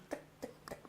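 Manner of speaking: soft voiceless "tac", evenly spaced; staccato popping syllables. Shown as a demonstration. Coach imitating little water jets leaving her mouth, soft staccato. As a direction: spit out tiny separate jets of sound. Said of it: "presque des petits jets d'eau qui quittent ma bouche".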